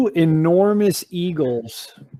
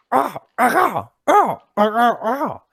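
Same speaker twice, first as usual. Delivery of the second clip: high, excited little eagle squawks; excited eagle chirps; 7 semitones higher than usual. Acted. Game master voicing a tiny eagle greeting the party, high excited chirps. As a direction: chirp like a thrilled baby eagle.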